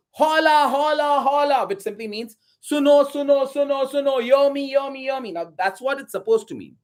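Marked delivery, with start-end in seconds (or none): 0.1-1.7 high, loud hollered call; town crier's yell. Acted. Messengers announcing to villagers in the city square, yelling out. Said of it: "they would yell out". 2.6-5.3 crier's calling out. Acted. Messenger explains the call to townspeople, yelling out. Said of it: "they would yell out".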